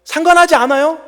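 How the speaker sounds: loud, high complaint; Korean retort. Acted. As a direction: snap it back loud and high. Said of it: "영어로 읽을 때 더 다가오는".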